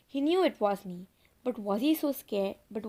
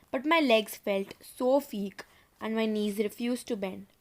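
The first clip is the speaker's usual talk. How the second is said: ordinary delivery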